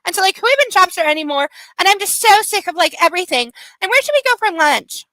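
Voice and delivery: put-on high, fast Valley Girl chatter; coked-up Valley Girl. Shown as a demonstration. Demonstrating a voice for listeners, coked-up Valley Girl. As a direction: rattle it off like a wired Valley Girl. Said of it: "That was a little coked up Valley Girl".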